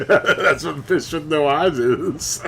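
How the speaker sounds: slow, giggling delivery of the punchline; can barely get it out for laughing. Real, not acted. Laughing while telling his friend the punchline.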